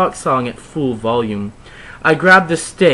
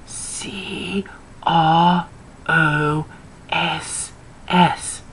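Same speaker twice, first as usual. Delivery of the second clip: 1 slow hushed spelling; 2 semitones higher, 6 dB quieter, much slower than usual. Acted. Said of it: "There's no way I'm writing that".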